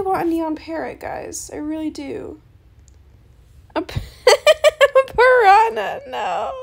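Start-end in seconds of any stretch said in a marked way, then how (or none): 3.7-6.5 high, loud laughing disbelief. Real, not acted. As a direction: laugh at the lowball offer. Laughing at a bad trade offer.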